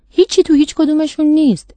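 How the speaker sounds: lightly voiced boy. A narrator's reading. Boy surveys all the empty jars, lightly childlike and puzzled.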